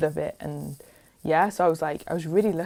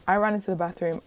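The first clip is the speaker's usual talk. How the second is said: usual speaking voice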